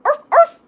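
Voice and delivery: high, loud dog-like yaps; bark. A narrator's reading. Sister mocking her brother's chihuahua act, barking. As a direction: yap sharply like a small dog. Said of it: "barked Juju Bee".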